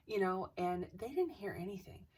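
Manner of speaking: usual speaking voice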